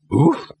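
high dopey woof; dog woof. Acted. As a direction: bark like a dim dog. Dog Laddie barks.

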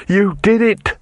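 full-throated scream of triumph; loud accusing scream. A narrator's reading. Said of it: "screamed Rincewind".

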